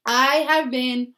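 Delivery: talking around a mint; mouth full. Real, not acted. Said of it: "I have a mint in my mouth".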